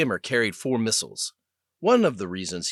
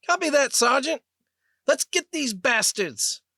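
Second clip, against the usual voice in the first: put-on eager young pilot; 4 dB louder than usual. Young pilot answering his sergeant over the comm, high and eager. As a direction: fire back a keen reply like a fired-up kid.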